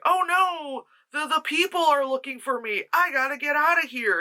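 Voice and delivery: high-pitched mock alarm; imitating a panicked escapee. Retells character fleeing the people.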